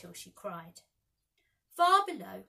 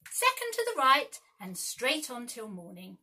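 bright, louder call; cried out; 3 semitones higher, 9 dB louder than usual. A narrator's reading. Peter answers Wendy with an eager cry. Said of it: "cried Peter".

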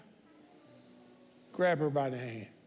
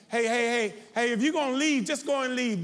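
louder, sharp call to someone leaving; scolding from the pulpit, voice raised; real rebuke; 3 semitones higher, 4 dB louder than usual. Real, not acted. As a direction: call them out as they walk away.

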